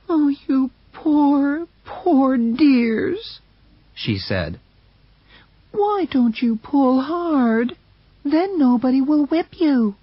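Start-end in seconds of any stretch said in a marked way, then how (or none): 0.1-3.4 high, slow cooing to the dogs; woman hugging the dog, cooing high and slow; tender pity. Acted. Mercedes hugging Buck. 5.7-10.0 coaxing. Acted. Mercedes coaxing the dogs.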